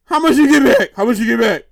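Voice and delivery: low, toned-down interrogating voice; family member asking. Acted. Family asking her about the reward, low-voiced. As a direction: drop the pitch for the suspicious relatives.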